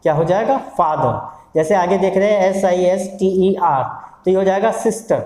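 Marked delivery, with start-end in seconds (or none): 0.8-1.2 फादर falling onto a schwa, no R; silent final R. Shown as a demonstration. Teacher answers own question, फादर with silent R. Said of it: "आर को हम silent रखेंगे". 4.8-5.2 सिस्टर ending in a schwa, R not sounded; silent final R. Shown as a demonstration. Teacher models it for learners, सिस्टर with silent R. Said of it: "उसमें आर नहीं है".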